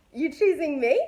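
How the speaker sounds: mock-stunned at being picked; amazed disbelief. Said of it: "And I was like".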